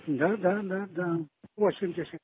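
normal talking voice

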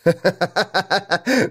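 high, rapid burst of acted laughter; sudden loud guffaw. A narrator's reading. Jarl suddenly laughing at his two men, a guffaw. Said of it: "и вдруг расхохотался".

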